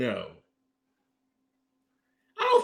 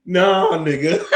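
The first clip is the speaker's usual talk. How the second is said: laughing disbelief; slower than usual. Real, not acted. Answering a friend's hope about the chicken sandwich, laughing disbelief. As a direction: blurt it out, breaking into laughter.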